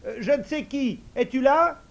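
hesitant idiot calling. Acted. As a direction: try the magic call timidly.

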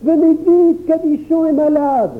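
put-on high, loud urgent child's call; appel affolé. A narrator's reading. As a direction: shout for help, panicked. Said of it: "s'écria le petit Jacques". (petit garçon qui appelle au secours, affolé)

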